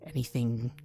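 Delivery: leaning in, threatening whisper; scheming whisper. Acted. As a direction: hiss a veiled threat. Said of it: "And she leans in".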